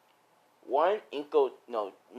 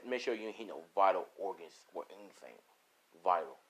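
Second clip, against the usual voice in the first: low, soft instruction; understated, lowered boss voice; villain boss; 5 semitones lower, 5 dB quieter than usual. Acted. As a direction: give a grim order quietly. Villain boss gives cold orders.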